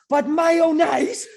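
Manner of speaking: slow, loud, high, laughing through the impression; outraged disgust. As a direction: sneer at the mayonnaise in mock fury. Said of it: "And he's like".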